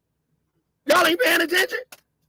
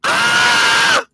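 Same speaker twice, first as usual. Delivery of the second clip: loud held yell of pain; imitated scream; 2 semitones lower, 5 dB louder than usual. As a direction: let out his long scream. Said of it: "he's screaming".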